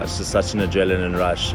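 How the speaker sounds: ordinary talk, nothing marked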